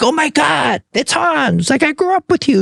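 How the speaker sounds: put-on high, shouted, thrilled young fans; excited recognition. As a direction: shout it like spotting a hometown hero. Kids recognising him, excited.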